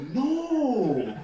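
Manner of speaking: usual speaking voice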